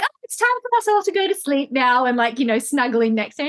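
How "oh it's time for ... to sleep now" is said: high, sleepy toy panda voice. Retold or imitated. Mother voicing panda telling everyone to sleep, high and playful. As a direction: coax everyone to bed as the panda. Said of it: "I'm like".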